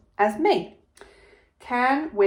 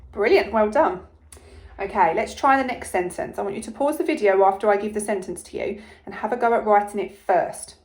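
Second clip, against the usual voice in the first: ordinary delivery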